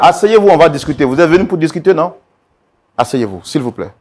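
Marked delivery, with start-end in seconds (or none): 0.0-2.1 loud, high command over shouting; urgent order. Acted. Mediator ordering the couple to sit, urgent shout. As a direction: cut through the row with a shouted order.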